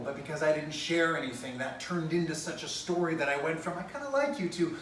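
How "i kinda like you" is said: high, small voice; acted-out timid, high-pitched confession; shy admission. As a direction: confess a little crush lightly. Shy admission set against desperate declaration.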